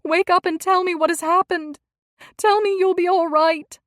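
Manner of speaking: high, loud desperate cry; wife begging her wounded husband; desperate pleading. A narrator's reading. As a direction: beg him to wake up. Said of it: "in a voice of sheer desperation".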